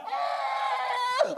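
very high shrieking yell with a laugh; startled scream. Retold or imitated. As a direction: scream as if the car is coming at you. Car roars backwards, startled.

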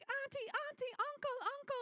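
high-pitched mock little kids' voices; imitated children calling. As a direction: pipe up like small kids calling the grown-ups. Imitating small kids clamouring for attention.

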